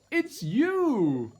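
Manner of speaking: high, bright exclaim; cheerful reveal. A narrator's reading. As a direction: tell him the happy surprise. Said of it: "they laughed and said".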